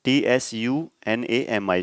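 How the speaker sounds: "टी एस यू एन ए एम आई" dictated slowly, letter by letter; careful slow dictation of "टी एस यू एन ए एम आई"; slow spelling. Shown as a demonstration. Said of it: "tsunami टी से भी start होता है".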